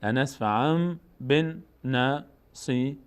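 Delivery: لنصفعم بن نصیه very slowly, m held before b; n turned to m. Shown as a demonstration. Teacher models the full reading, لنصفعم بن نصیه slow with held m. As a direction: hum لنصفعم, then بن نصیه syllable by syllable.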